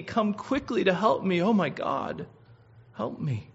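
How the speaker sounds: drops to a soft, spent whisper of help; plea fading to a hush. Acted. Preacher ending David's desperate prayer, fading softly. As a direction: let the cry die away in exhaustion. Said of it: "You ever tell God to hurry?".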